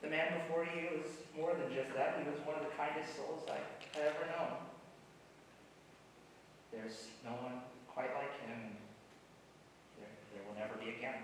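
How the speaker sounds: quiet, tender tribute; soft sincere eulogy. Acted. Friend closing the eulogy, quietly tender. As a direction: let it sink, gently.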